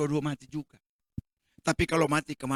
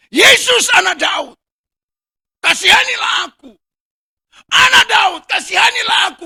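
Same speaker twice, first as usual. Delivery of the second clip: desperate shout; 13 semitones higher, 15 dB louder than usual. Acted. Blind Bartimaeus crying out to Jesus, desperate shout. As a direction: cry out for mercy at full voice. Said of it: "Karuan dia teriak".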